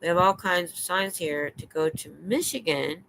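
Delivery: ordinary delivery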